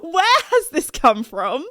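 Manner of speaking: slow, loud, incredulous question; stunned disbelief. Retold or imitated. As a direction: drag it out in disbelief. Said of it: "And I was just like".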